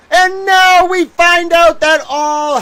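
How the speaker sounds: high, loud outraged build; rising rant. Real, not acted. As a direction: whip up the outrage. Ranting about filings.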